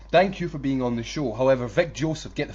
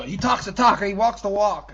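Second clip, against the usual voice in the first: mock commentator catchphrase; 7 semitones higher, 7 dB louder than usual. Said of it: "the checklist of W W E sentences".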